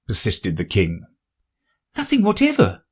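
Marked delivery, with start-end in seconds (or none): 2.0-2.8 put-on high girlish voice; Alice, high and polite. Alice answers king.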